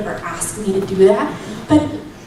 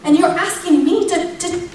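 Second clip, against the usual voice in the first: retold protest, higher, half laughing at herself; exasperated plea; 4 semitones higher than usual. Mom asks God why he picked her, exasperated plea. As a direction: plead upward in disbelief, faltering before the end. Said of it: "I'm dead serious in that moment".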